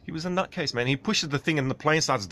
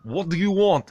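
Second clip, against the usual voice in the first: loud, higher mock Russian librarian, curt; gruff librarian; 8 semitones higher, 6 dB louder than usual. Imitating the Russian librarian greeting refugees, loud and curt. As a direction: bark the greeting like a stern librarian. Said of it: "but she was like".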